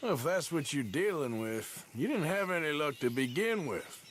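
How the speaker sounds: ordinary delivery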